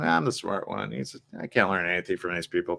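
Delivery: low, muttered know-it-all; mock self-importance, thrown away softly; acting the smart one. Mimicking someone who thinks they're smartest, smug and muttered. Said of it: "then you start acting".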